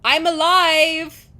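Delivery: drawn-out loud shout to stop them; loud slow cry. Acted. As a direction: yell it slowly so they hear you're alive. Heroine wakes before being dissected.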